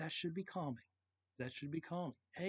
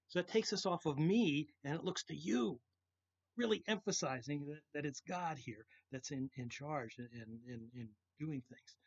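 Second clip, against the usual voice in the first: usual speaking voice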